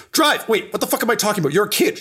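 high yelled order tumbling into fast self-correction; Kel's frantic yell. Acted. Game master as getaway leader ordering a kid to drive, frantic high yell. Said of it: "she yells".

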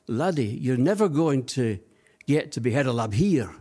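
imitated Glaswegian boss putting someone down; mock Glaswegian accent; Scottish accent. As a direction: do the Scottish director's emphatic refusal. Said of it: "in his Scottish accent".

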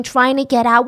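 normal talking voice

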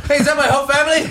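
high, loud squeal through laughter; hammed-up doomsday caller, laughing; panicked skit voice. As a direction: cackle out a frantic confession. Mock panicked victim skit.

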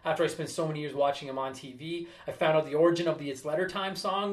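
ordinary talk, nothing marked

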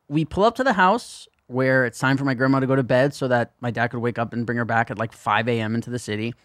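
ordinary talk, nothing marked

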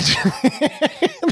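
high amused giggle; real laugh. Real, not acted. Host laughs at roommate story, high giggle. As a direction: laugh out at her confession.

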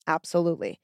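ordinary delivery

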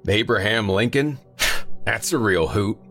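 chortling. Acted. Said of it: "Earl chortled".

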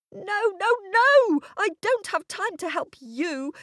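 put-on high, indignant child refusal; stamping little girl. A narrator's reading. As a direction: protest loudly at first, like a foot-stamping child. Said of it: "stamping her foot".

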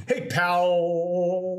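"pal" with the L held on and on; "pal" trailing into a long, unbroken L; drawn-out final L. Shown as a demonstration. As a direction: hold the soft L at the end of "pal" as if unfinished. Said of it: "the L is such a soft letter".

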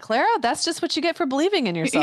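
high, laughing mock lesson; laughing joke. Mom teasing daughter about the egg, laughing high. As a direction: tease her through laughter.

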